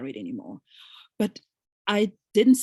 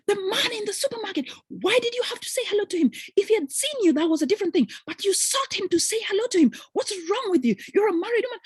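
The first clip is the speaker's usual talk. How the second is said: jealous husband scolding; 12 semitones higher than usual. Retold or imitated. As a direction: nag like the jealous husband, pitched up. Husband keeps scolding her.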